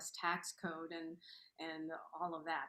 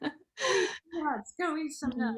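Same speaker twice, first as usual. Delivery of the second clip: playful; 9 semitones higher, 11 dB louder than usual. Real, not acted. Joking advice amid laughter.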